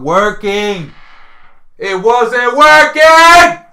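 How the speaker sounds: loud, high, slowed yell of disbelief; rising into a loud, high shout; outraged shout. Real, not acted. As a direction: shout it at the screen. Outraged at the news detail.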